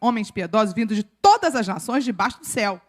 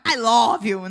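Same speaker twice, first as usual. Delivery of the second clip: brisk Bahian-accented English; 4 dB louder, much slower than usual. Shown as a demonstration. Contrasting with the foreign version, Bahian accent. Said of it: "E o sotaque baiano".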